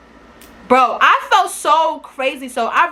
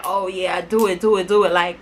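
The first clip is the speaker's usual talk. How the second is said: low-pitched, sly urging on; mock low-key goading, pitched down; fake egging on; 5 semitones lower than usual. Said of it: "she probably was fake".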